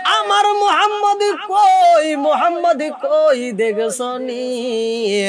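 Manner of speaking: high chanted wail easing down to a drawn-out plea; sung lament for a lost child; wailing cry. Acted. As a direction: start high in anguish, then sink slowly. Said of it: "কান্দে আর কয়".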